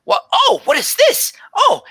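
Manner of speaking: very high, breathless delight; excited tabaxi druid. Acted. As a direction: gush like a wide-eyed scholar.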